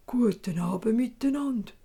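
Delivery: whispery little dwarf greeting; dwarf greeting the startled pair. Acted. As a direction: greet them like a secretive dwarf. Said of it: "sagt der Zwerg".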